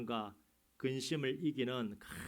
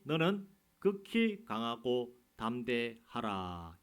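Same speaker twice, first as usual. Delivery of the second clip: slow, weighty scripture read, each word drawn out; solemn slow reading; 4 dB louder, much slower than usual. Acted. Pastor reading God's words to Joshua, slow and solemn. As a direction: lay the command down slowly and heavily.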